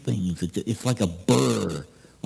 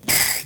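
zoom. Retold or imitated. Second car zooming past.

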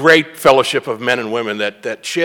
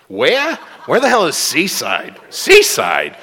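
incredulous; 4 semitones higher, slower than usual. Retold or imitated. Incredulous about trip to Seaside.

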